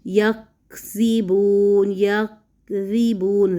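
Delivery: very slow repeated یکذبون; drawn-out recited word; tajweed recitation. Shown as a demonstration. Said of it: "تجوید کا رول بھی یہی ہے،".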